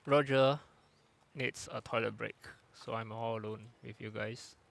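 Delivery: normal talking voice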